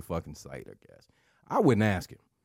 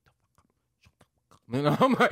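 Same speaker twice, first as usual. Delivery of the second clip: mouthed; 6 semitones higher, 5 dB louder than usual. Retold or imitated. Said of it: "mouthing to me like".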